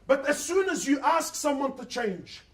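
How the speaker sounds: ordinary delivery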